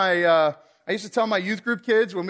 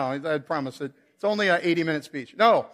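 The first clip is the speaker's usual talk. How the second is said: ordinary talk, nothing marked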